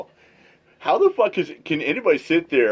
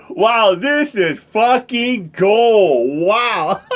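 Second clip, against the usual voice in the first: high, cackling laughter; 8 semitones higher, much slower than usual. Real, not acted. Watcher laughing hard at the streamer's rage. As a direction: crack up at the meltdown.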